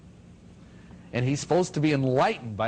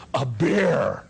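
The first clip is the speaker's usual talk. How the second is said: a hot, worn-out man half-crying for a beer; blurted answer. Retold or imitated. Sweaty initiate answering the master, a mock blurted cry.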